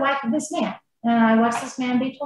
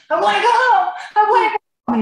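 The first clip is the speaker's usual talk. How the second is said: imitated loud, high wailing plea; Lance; 10 semitones higher, 4 dB louder than usual. Wife voicing husband as he jerks her collar, loud crying plea. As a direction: cry it out like an insistent small child. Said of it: "he's just crying. He's crying".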